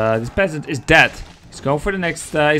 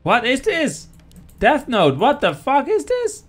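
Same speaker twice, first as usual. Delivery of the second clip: higher-pitched, baffled exclamation; incredulous outburst; 11 semitones higher, 4 dB louder, slower than usual. Real, not acted. Caster reacting to a baffling strategy, incredulous. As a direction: blurt it out in baffled surprise.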